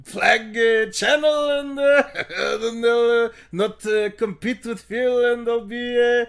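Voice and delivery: slow, halting mock of his buddy; lightly exaggerated, slow drawn-out friend voice; impression of friend. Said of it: "Can I do my impression of you to you".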